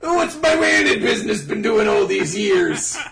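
pirate voice. Retold or imitated. Pirate bit continues.

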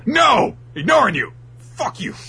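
very high, loud angry refusal; furious Chopper. Acted. Chopper refusing the juice dude, very high loud shout. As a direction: shout him down.

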